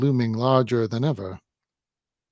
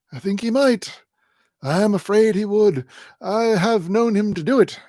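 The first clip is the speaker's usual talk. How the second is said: put-on high, reedy old miser; a cagey old man letting slip too much; old man Smallweed; 8 semitones higher, 4 dB louder than usual. A narrator's reading. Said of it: "says Grandfather Smallweed incautiously".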